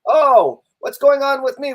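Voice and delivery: high, loud mock surprise; surprised echo. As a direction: act startled by the question.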